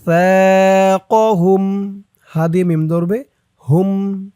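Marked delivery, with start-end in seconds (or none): 0.1-1.6 ثاقهم with ثا held one alif; long tha. Shown as a demonstration. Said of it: "এক আলিফ টান". (ثাকহুম-এ ثا এক আলিফ টেনে)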